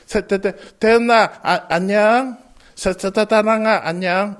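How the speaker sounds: repeated stutters on the first sounds; stammering. Shown as a demonstration. Moses greeting a friend, stammering badly. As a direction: stumble and stammer over the name. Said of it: "이렇게 말을 해야 되는데 모세는".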